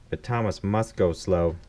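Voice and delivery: usual speaking voice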